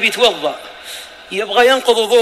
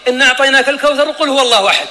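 quick, hurried mock reading of short suras; rushed recitation; faster than usual. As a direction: rattle off the short suras to finish fast. Mocks hasty recitation in prayer. (تلاوة مستعجلة ساخرة)

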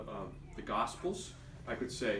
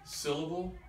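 Syllable with SYL punched higher; SYL-la-ble, raised first beat; stress on first syllable; 6 semitones higher than usual. Shown as a demonstration. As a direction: hit the front of Syllable. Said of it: "I moved the accent from each".

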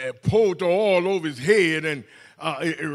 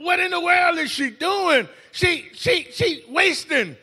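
higher, indignant mock voice; mocking the critics; 9 semitones higher than usual. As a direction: imitate the griping disciples. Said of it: "And they said".

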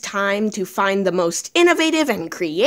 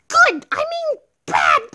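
very high, squeaky little voice; high-pitched mushroom kid; distressed little mushroom. Acted. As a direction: answer as a tiny upset creature. Little mushroom answers the greeting.

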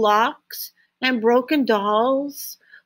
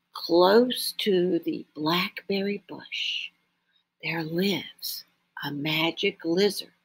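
mysterious whisper; 5 semitones lower, 4 dB quieter than usual. A narrator's reading. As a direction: lean in and whisper the secret. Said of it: "he whispered mysteriously".

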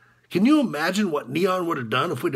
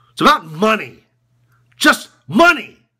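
loud, slowing hard on the last words; loud, deliberate disgust; bitter emphasis; 4 semitones higher, 7 dB louder than usual. Acted. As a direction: hammer each word with rising force. Coach's bitter confession about money.

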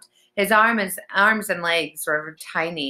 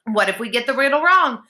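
high, alarmed question; higher, anxious boy; worried objection; 7 semitones higher, 4 dB louder than usual. A narrator's reading. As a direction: fret about getting it wrong.

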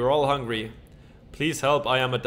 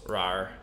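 low soft dinosaur rawr; dinosaur roar; 7 semitones lower, 3 dB quieter than usual. Pretend. Dinosaur failing at doors, low rawr. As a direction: give a little rawr.

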